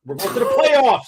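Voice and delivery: high, drawn-out excited cry; mock playoff hype. Mock excited playoff shout on joining in.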